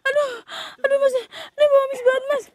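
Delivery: high, whining moans of distress; whimpering. Real, not acted. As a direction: whimper as if about to cry. Overwhelmed by the fishy smell.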